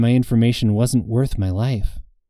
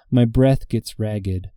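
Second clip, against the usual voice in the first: usual speaking voice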